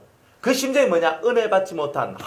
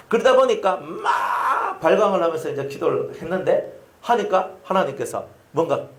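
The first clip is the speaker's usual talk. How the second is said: ordinary talk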